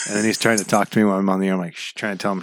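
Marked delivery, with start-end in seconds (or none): 1.7-1.9 hushing. Retold or imitated. Hushing son during radio show.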